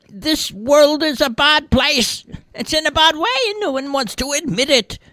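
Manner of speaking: put-on high-pitched old lady scolding the TV; indignant old woman, shrill-high and insistent; batty old lady. A narrator's reading.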